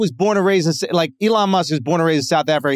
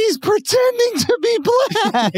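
very high, drawn-out squeal of amusement; incredulous amusement; 13 semitones higher, slower than usual. Real, not acted. Comedian explaining why Sean King is funny, high-pitched amused disbelief. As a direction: let the voice shoot right up, slowly.

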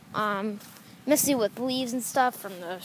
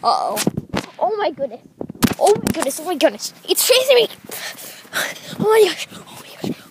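panicked; 6 semitones higher, 4 dB louder than usual. Real, not acted. Being chased by groundhog.

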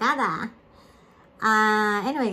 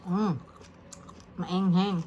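chewing while talking; eating spicy chili; mouth full; 2 semitones lower, slower than usual. Real, not acted. Seen 0: bit chili at -1.0, holds chili, chews while speaking.

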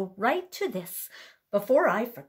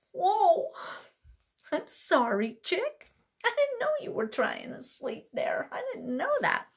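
higher, sheepish apology; apologetic animal; 7 semitones higher than usual. Acted. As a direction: say sorry to the sleepy chick.

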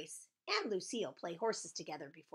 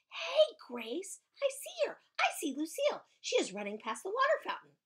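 excited calling out; 13 semitones higher, 5 dB louder than usual. Acted. Calling out to friend on sighting.

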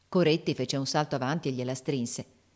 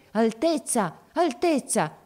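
high, loud urgent cry; shouting; 6 semitones higher, 4 dB louder, slower than usual. A narrator's reading. Soldier recalls the cry to the prince in battle, shouting. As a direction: call out sharply to the prince. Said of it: "Io intesi gridare".

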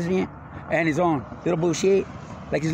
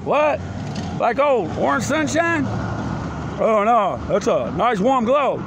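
higher and louder, all worked up; loud excited outburst; 7 semitones higher, 3 dB louder than usual. Real, not acted. Ribbing a buddy about glow worms, loud and excited. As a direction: crank it up like you're hyped. Rowdy riffing at a friend.

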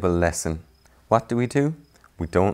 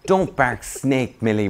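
scolding meerkats; 6 semitones higher, 6 dB louder, slower than usual. Acted. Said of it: "repeated the other meerkats".

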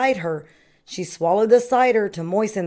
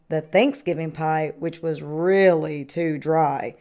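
plain reading voice

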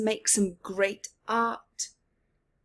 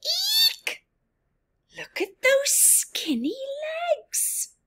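squeaky, much higher cat voice, breathy whisper on the insult; mocking cat; slower than usual. Cat teasing Bob about his skinny legs, squeaky sneer.

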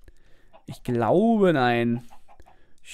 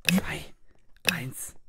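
whisper countdown; 2 semitones lower, 7 dB quieter than usual. Real, not acted.

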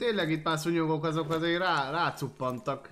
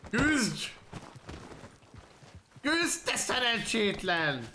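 high, drawn-out taunting yell; goading shout; 9 semitones higher, much slower than usual. Real, not acted. Player egging on a losing opponent, goading shout. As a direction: goad a beaten opponent to fight.

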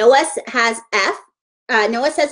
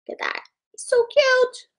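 put-on high sweet baby-talk voice; cutesy cooing; 13 semitones higher, 3 dB quieter than usual. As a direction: coo over the little toy. Cooing over frog toy.

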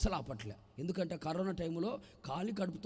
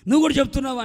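irritated; 7 semitones higher, 15 dB louder, faster than usual. Acted. Irritated retort.

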